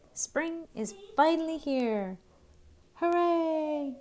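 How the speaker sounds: high, cheering little mouse voice; excited mouse. Acted. Mouse tells Bird spring is here, cheering high. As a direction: squeal the hooray with joy.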